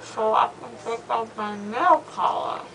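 slurred mouth full of gauze; drowsy, numb mouth talk; after wisdom teeth. Real, not acted. Still loopy with gauze in mouth.

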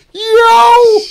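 very loud, very high jolt of a yell; startled yelp. Retold or imitated. Sleeping man jolted awake by a pinch, shocked yell. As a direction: yelp like you were just pinched awake.

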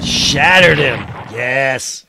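loud burst of exultation; triumphant shout. Real, not acted. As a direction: roar with delight at the victory. Player celebrates destroying enemy unit.

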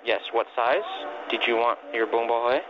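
ordinary delivery

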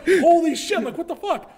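loud, very high retold disbelief; high, loud swearing in astonishment; shocked outburst. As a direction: relive the shock at full pitch. Acted shock at sudden kill.

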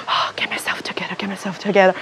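breathy rushed whisper to herself; whispered self-pep talk. Retold or imitated. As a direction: whisper fast, pulling yourself together.

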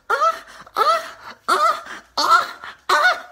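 very high, loud put-on moaning; mock high moans. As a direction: squeal like a cartoon orgasm. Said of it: "I'm like".

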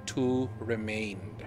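slow dramatic. Acted.

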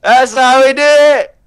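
high, loud victory yell; triumphant shout. Real, not acted. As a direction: crow over the win. Celebrating the kill.

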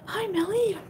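squealy hello to chat; high excited greeting. Real, not acted. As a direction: greet each viewer like a long-lost friend. Greeting viewers on a live stream.

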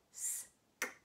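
S-k-u-l whispered sound by sound; whispered sound segmenting. Shown as a demonstration. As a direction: breathe each sound of S-k-u-l apart. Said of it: "listening for each sound".

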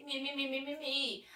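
slow mocking whine. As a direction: drag out the whining mockery. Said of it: "aquelas histórias todas de médico".

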